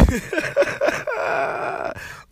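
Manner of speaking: high breathless cackle, loud; high wheezing laugh. Real, not acted. Laughing at his own neighbor gossip, high wheezing cackle.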